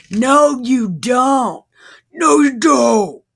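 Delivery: loud, high-pitched outburst of disbelief; exasperated shout. Real, not acted. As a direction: yell it back at a liar. Yells back at Peter's lie.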